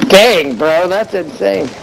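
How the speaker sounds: normal talking voice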